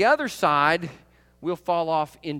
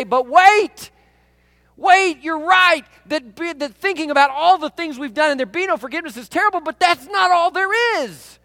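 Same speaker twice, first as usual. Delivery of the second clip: loud, high pleading cry to the screen; urgent call; 9 semitones higher, 7 dB louder than usual. Pretend. Preacher crying out to the movie characters, loud and urgent. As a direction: call out to stop the story, urgent. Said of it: "I want to say".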